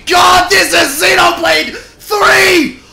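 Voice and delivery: high, loud, drawn-out shout of disbelief; ecstatic shouting. Real, not acted. Fan reacting to Xenoblade three reveal, ecstatic shouting. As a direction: scream it out, stretching every word. Streamer stunned by game announcement.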